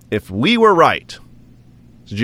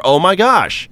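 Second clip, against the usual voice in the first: normal talking voice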